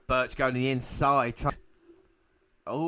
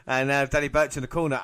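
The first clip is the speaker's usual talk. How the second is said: ordinary talk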